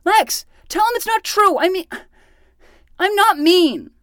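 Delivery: high, loud whining plea; whiny protest. Acted. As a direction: whine it like a wounded kid. Kid whining to sister's friend.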